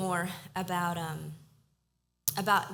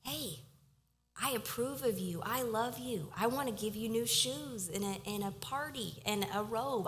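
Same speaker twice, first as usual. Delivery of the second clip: tender God; 4 semitones higher than usual. Retold or imitated. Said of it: "when God's like".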